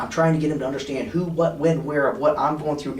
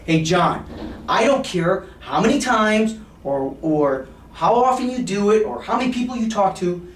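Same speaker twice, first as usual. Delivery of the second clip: loud, high mock-stern warning; 4 semitones higher, 4 dB louder than usual. Shown as a demonstration. Salesman pretending to scold his client, mock stern. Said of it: "I'll be very stern in my voice".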